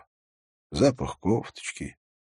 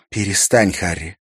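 sharp, louder cut-in; 3 semitones higher, 7 dB louder than usual. A narrator's reading. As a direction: cut him off sharply, mildly raised. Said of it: "Это прозвучало резко".

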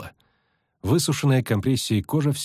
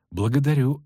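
"Благодарю" at normal pace; lightly ironic, moving straight on; brisker thanks; 5 semitones higher than usual. Acted. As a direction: say "Благодарю" without drawing it out. Thanks repeated, brisker and higher.

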